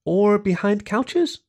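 high slow hopeful question. A narrator's reading. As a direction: ask sweetly, hoping for yes. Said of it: "Jenna asked hopefully".

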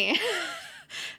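a quick high-pitched laugh; amused high laugh at the quote. Real, not acted. Laugh after acted quote.

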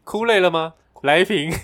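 loud, lightly hammed-up commercial slogan; mock ad pitch. Friend improvising their water advert, mock ad pitch. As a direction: pitch the drink like a jokey advert. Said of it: "我们的广告就是".

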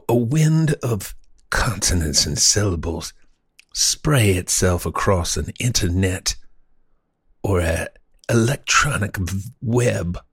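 halting, grandiose mock-genteel speaker; florid old-time orator. Host proffering a bold epigram, as a florid orator. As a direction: savor every pompous word with long pauses.

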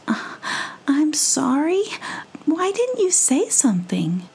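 high, flustered squirrel voice; sorry and a bit rattled; apologetic squirrel. Acted. Squirrel apologizing to the dizzy mouse, high and flustered. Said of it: "Stella apologizing".

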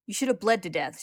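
loud, bitter snarl. Acted. Lust snarling at Roy as she heals. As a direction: snarl it through the pain. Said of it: "she snarls".

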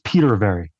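Peterberry with a long ee; PETER pronunciation. Shown as a demonstration. Guest showing how his surname is said, Peterberry with long ee. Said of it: "it's spelled".

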